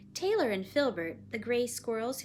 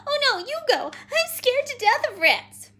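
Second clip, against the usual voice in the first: high, loud refusal; scared little girl; 13 dB louder than usual. Acted. Child refuses out of fear.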